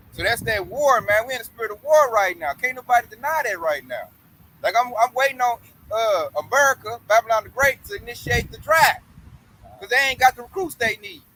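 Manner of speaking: ordinary talk, nothing marked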